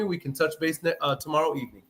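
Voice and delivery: ordinary delivery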